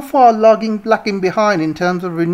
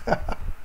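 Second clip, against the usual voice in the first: a quick real chuckle; soft amused laugh; short laugh; 12 dB quieter than usual. Real, not acted. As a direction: laugh at the joke. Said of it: "Oh, that's so funny".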